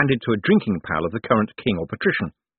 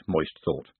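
ordinary delivery

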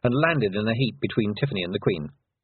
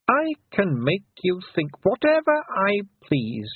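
higher, mocking fairy queen; 6 semitones higher than usual. A narrator's reading. Queen taunting Tiffany, high and menacing. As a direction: taunt her with silky menace.

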